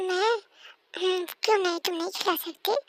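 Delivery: usual speaking voice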